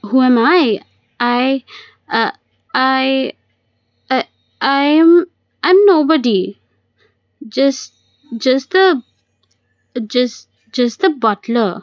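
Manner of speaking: halting, with long searching pauses; flustered stammering. Acted. Man on the phone inventing who he is, flustered stammer. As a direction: stumble for an answer, voice lifting.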